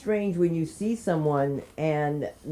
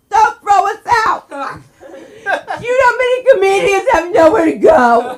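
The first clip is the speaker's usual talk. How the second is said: high pleading scream at the landlord, much louder; 14 semitones higher, 11 dB louder, slower than usual. Retold or imitated. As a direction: beg him at the top of your lungs, half crying. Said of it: "and I'm screaming".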